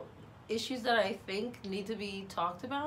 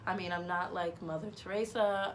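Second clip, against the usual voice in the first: ordinary talk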